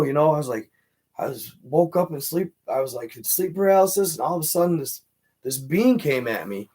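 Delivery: usual speaking voice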